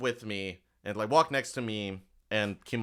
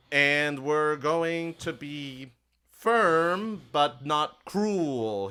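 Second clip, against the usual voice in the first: slow, every word weighted; emphatic; 4 semitones higher, much slower than usual. Acted. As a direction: lay it down firmly and slowly. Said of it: "he will sort of say the last words very emphatically".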